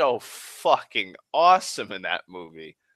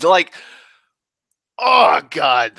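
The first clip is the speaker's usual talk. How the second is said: normal talking voice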